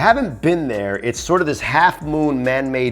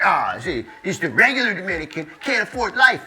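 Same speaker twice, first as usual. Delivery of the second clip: pitched-up parody of a moaning commenter; mock moaning; 6 semitones higher, 3 dB quieter than usual. Commenter moaning on behalf of Dominicans, mock moaning. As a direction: whine it in mockery, climbing higher. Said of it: "how I hear it when you bitch and moan".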